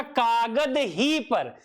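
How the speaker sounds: slow "कागद" as one word, then a stressed "ही पर"; break after कागद. Shown as a demonstration. Teacher giving the paper reading, break after "कागद". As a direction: split it as "कागद, ही पर". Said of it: "तीसरा".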